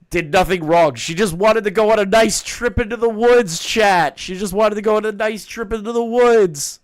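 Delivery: high, loud over-the-top defence; mock pleading. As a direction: plead her case in mock distress.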